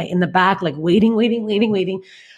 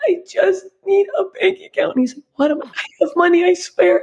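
much higher, teary, pleading; crying plea; 13 semitones higher than usual. Retold or imitated. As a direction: beg through tears. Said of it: "started crying".

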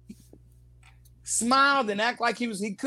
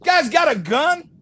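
loud, higher retold yell at an actor; director yelling cut; 4 semitones higher, 5 dB louder than usual. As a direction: bark it like an angry director stopping the take. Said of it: "and Ron goes".

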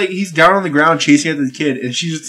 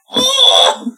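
very high, cooing "oh"; adoring squeal; 4 dB louder than usual. Retold or imitated. Fan seeing beloved actress grown old, adoring squeal. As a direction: squeal as if melting at something cute. Retold reaction to cute old lady.

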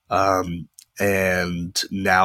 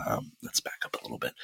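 soft whisper, pulled back from the mic; whispered aside after leaning too close; 9 semitones lower, 14 dB quieter, faster than usual. Real, not acted. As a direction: drop to a hushed whisper. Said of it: "I got really close to my mic".

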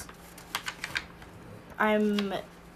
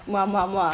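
air kisses; 3 semitones lower than usual. Acted. Blowing kisses to podcast listeners.

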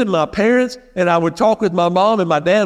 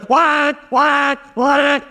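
harsh alarm imitation; 7 semitones higher, much slower than usual. Preacher mimics his old alarm blaring him awake, high and harsh. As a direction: bark it out like a jarring old alarm. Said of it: "that thing would go".

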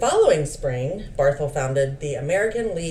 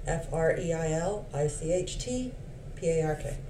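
spelling out letters; 7 dB quieter than usual. Shown as a demonstration. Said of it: "No, there's no D's".

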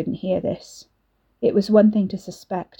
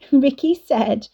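a giggly taunt, higher; giggling; 6 semitones higher, 4 dB louder, slower than usual. Acted. Nicole taunting Katie with a giggle. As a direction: giggle through the words. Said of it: "Nicole giggled".

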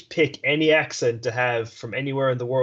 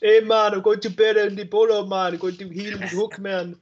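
high-pitched, hammed-up Jamaican accent; 7 semitones higher than usual. Host jokes after talk of Jamaican English, mock Jamaican accent. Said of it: "sorry to all the Jamaican people".

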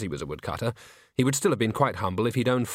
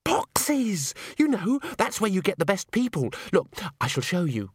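gushing Christine; 8 semitones higher than usual. Acted. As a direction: show off the theatre with gushing delight.

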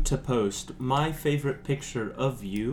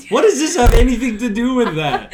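higher, louder, laughing "what?!"; laughing disbelief; 12 semitones higher, 10 dB louder than usual. Real, not acted. Reacting to a friend's odd question, laughing disbelief. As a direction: burst out, incredulous and laughing.

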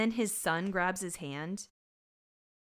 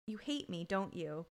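retold hurt question; 2 semitones lower, 8 dB quieter than usual. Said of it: "sitting on the bed and he goes".

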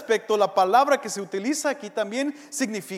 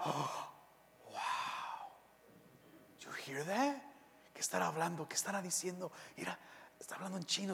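softer, hushed amazement, rushing; hushed awe; 8 dB quieter, faster than usual. Retold or imitated. Churchgoers marvel in hushed voices at tongues, softer and hushed. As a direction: murmur like a stunned onlooker in church.

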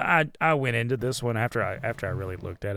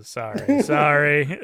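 laughing apology; 4 semitones higher, 10 dB louder, much slower than usual. Real, not acted. Laughing apology for spoiling his turn.

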